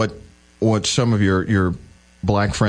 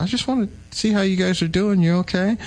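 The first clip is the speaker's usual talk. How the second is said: put-on elderly aunt voice; 10 semitones higher than usual. Aunt calling after the hurricane, higher old-lady voice. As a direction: voice the worried old aunt on the phone.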